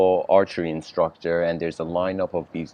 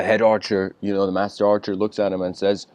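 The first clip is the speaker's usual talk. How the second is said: usual speaking voice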